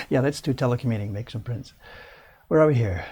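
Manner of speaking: normal talking voice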